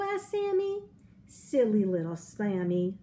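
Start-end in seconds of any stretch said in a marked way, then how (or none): none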